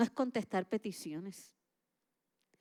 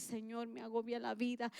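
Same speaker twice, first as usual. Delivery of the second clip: soft honest prayer; 8 dB quieter than usual. Pretend.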